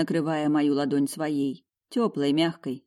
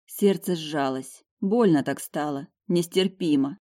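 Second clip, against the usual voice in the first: ordinary delivery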